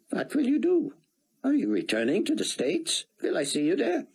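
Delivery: high, aged scholar voice, measured; an old academic bidding farewell; elderly scholar. Acted. Rosenzweig voiced again.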